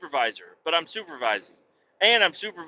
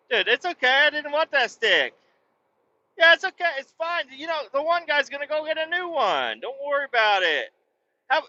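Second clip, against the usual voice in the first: much higher, sugary over-friendly voice; fake-nice buddy; 11 semitones higher than usual. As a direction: lay on the fake niceness to cheer up the sad coworker. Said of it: "as nice and friendly as I possibly could".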